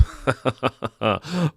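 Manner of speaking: a smug put-on cackle at his own trolling; mock gleeful laugh. Host laughing to bait conservative viewers, smug cackle. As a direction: laugh like he's poking the bear. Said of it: "See what I'm doing there?".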